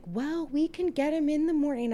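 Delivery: high sweet nun voice; prim nun voice. Retold or imitated. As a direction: mimic a calm little nun.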